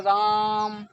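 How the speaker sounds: राम called out loud with the vowel आ held long; calling out a name. Shown as a demonstration. Teacher calls out to Ram to show the long vowel, loud. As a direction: hail राम across a distance, stretching the vowel. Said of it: "जब उनसे बुलाएंगे तो क्या बोलेंगे?".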